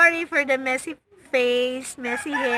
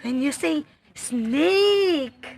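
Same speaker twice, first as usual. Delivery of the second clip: slow; 4 dB louder, much slower than usual. Shown as a demonstration. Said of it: "slow teaching to toddler".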